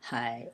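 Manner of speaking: "Hai" in a low, dipping third tone. Shown as a demonstration. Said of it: "third tone".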